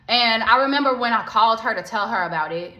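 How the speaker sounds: usual speaking voice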